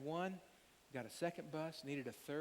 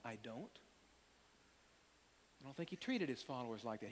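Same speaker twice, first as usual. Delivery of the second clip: usual speaking voice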